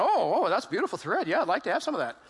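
hammed-up oohing and admiring, swooping up and down; impressed emperor. Emperor admiring the charlatans' thread, hammed-up delight. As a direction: play a gullible, delighted emperor. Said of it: "And he's going".

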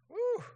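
short high "woo"; loud, high-pitched whoop; excited whoop. Shown as a demonstration. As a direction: whoop with playful glee. Excited thought of Amazon.

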